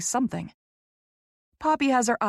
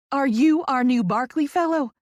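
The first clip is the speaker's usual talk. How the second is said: high, loud Southern gush; Southern accent, eager and loud; thick Southern accent; 6 semitones higher, 6 dB louder than usual. A narrator's reading. Poppy meeting the new fellow, eager thick Southern accent. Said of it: "She's got a thick Southern accent".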